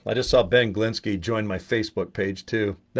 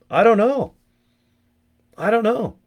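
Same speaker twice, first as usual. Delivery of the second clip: high, helpless I-don't-know, imitating a confused newbie; mock helpless confusion; 9 semitones higher, 4 dB louder than usual. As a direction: pitch way up, throwing up his hands in confusion. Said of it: "I go".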